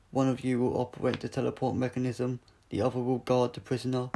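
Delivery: loud, flat robotic commands; a machine giving cold orders; Cyber leader. Acted. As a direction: metallic voice ordering the troops.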